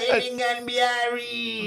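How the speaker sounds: high, loud mock Jamaican accent; hammed-up Jamaican accent, ending stretched. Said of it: "with the fucking accent".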